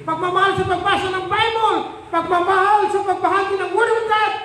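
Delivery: high, driving sermon list; full-force preaching. Acted. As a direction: preach each phrase high and insistent.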